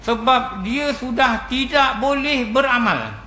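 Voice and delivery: slow, measured, each word weighted; slow emphatic answer. Retold or imitated. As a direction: hand down the answer slowly and gravely. The Prophet's answer.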